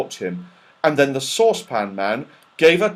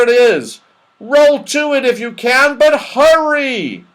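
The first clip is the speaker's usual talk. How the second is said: great excitement; 12 semitones higher, 8 dB louder than usual. Acted. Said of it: "lifted up in the greatest excitement".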